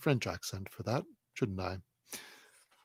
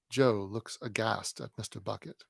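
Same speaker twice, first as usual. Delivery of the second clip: ordinary delivery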